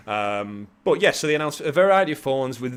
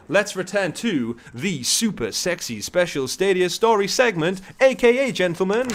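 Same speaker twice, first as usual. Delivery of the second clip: announcer voice; 6 semitones higher, 4 dB louder than usual. Acted. Announcing the segment intro.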